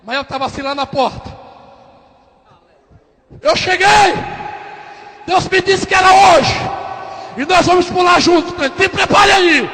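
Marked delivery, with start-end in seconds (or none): 3.4-9.7 loud, high proclamation; exultant shout. Acted. Believer bursting into the ward to announce the miracle, exultant shout. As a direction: shout it out like a triumphant arrival. Ismael arrives boldly at the door.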